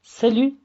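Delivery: Salü said high and loud, sely, no t; Salü with the ü sound stressed up; French salut. Shown as a demonstration. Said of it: "Kelimenin kökü aslında salüdür".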